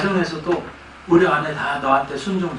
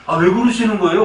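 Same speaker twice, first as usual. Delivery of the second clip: mock angry outburst; 5 semitones higher, 5 dB louder than usual. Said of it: "내가 성질을 냈어요".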